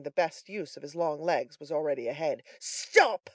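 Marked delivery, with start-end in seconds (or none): 2.6-3.3 high, loud command; shout. A narrator's reading. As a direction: shout to halt a runaway. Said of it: "shouting after Toby Crackit".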